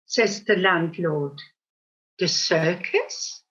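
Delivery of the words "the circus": The circus? said low, slow and soft, baffled; puzzled question. Acted. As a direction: let The circus? sink low and slow in disbelief. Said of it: "the duck inquires".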